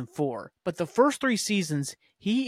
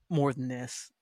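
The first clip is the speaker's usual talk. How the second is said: normal talking voice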